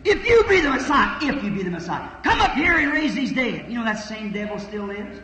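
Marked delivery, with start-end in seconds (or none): 0.0-1.2 mocking priests. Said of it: "he heard priests saying". 2.2-3.7 mocking priests. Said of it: "he heard priests saying".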